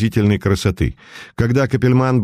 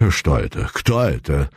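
trembling voice; 8 semitones lower than usual. A narrator's reading. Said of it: "дрожащим голосом спросила баронесса".

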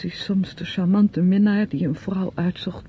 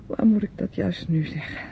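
normal talking voice

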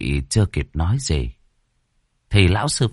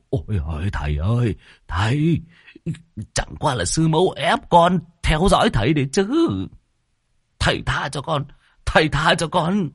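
higher pained begging; pleading pupil; 7 semitones higher than usual. Acted. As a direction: whimper for mercy with ear twisted. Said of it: "kêu ôi ối".

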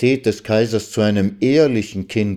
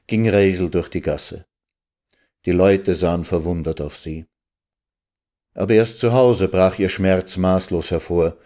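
ordinary delivery, nothing marked

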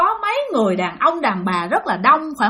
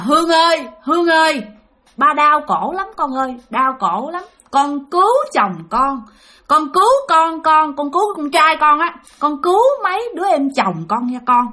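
pleading spirit; 4 semitones higher than usual. Retold or imitated. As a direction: imitate an elder in pain begging for help. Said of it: "xoay qua nói với mẹ bạn".

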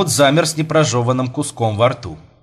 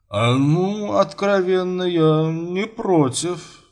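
higher, very slow, dithering drawl; hesitant consent; 7 semitones higher, much slower than usual. Acted. Father giving in to the suitor, hesitant and drawn-out. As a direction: give in haltingly, stretching the vowels.

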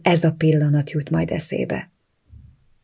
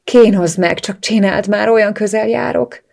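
loud begging, climbing higher toward the end; desperate plea; 4 semitones higher, 6 dB louder than usual. A narrator's reading. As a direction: beg urgently, pitch rising as it goes. Continued desperate begging of partner.

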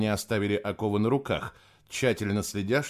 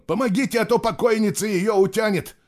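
high, loud cry for help; desperate shout; 11 semitones higher, 5 dB louder than usual. A narrator's reading. Nicholas begging help before she is dragged under, desperate. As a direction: shout urgently for someone to help.

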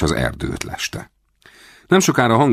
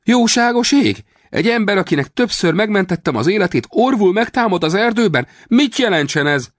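staged outrage; 4 dB louder than usual. A narrator's reading. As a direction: wail in mock betrayal. Rawlins feigning outrage. (eljátszott felháborodás)

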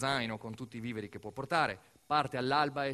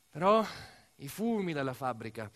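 ordinary delivery

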